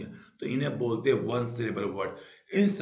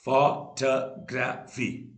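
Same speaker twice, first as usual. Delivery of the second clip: Photography slowly split into four beats; syllable by syllable; much slower than usual. Shown as a demonstration. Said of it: "जिसमें चार syllable होते हैं".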